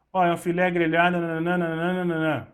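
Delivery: rattled off fast and bored; mechanical recital. Shown as a demonstration. Bad waiter reciting the dish mechanically, fast and dull. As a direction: recite it like a robot, quickly. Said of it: "repetir as receitas de forma mecânica".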